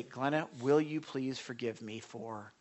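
soft, humble request for forgiveness; quiet sincere plea. Acted. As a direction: ask earnestly and gently. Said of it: "No, you ask forgiveness".

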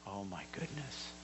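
lightly awed; overwhelmed hush. Retold or imitated.

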